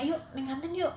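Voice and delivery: lightly acted casual nudge to a friend; casual informal invite. Teen inviting a friend to the canteen, casual and informal.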